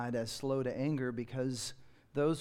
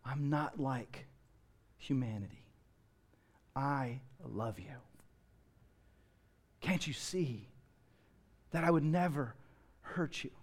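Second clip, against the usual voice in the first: tender voice of God; 5 dB quieter than usual. Acted. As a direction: speak like a gentle father. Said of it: "God says to you today".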